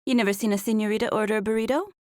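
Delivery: fast, hammed-up Mexican accent with rolled R's; thick Mexican accent. A narrator's reading. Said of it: "I really rolled the R's that time".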